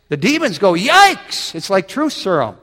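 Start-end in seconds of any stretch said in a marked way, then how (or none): 0.7-1.5 high, loud mock shriek; alarmed yelp. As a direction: yelp as if suddenly exposed. Demons and man recoil at truth.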